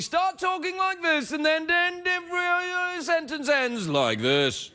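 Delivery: posh British announcer voice; British broadcaster. Pretend. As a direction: speak like a British TV presenter. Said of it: "Let's talk like British T V announcers".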